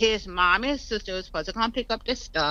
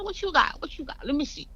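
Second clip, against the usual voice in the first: high, coaxing sing-song; retold indulgent curiosity in a high voice; nice and calm; 11 semitones higher, faster than usual. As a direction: coax him sweetly, pitched well up. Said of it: "like, nice and calm".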